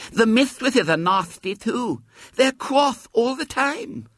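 high, slow lisping grievance; aggrieved little girl Violet Elizabeth. A narrator's reading. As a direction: complain like a small lisping child. Narrator voices Violet Elizabeth.

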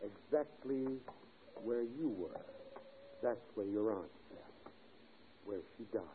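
quiet and ominous; sinister hush. Acted. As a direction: let it sink in quietly. Sinister hint about the ghost.

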